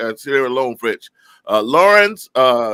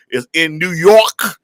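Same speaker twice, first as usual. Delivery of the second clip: ordinary talk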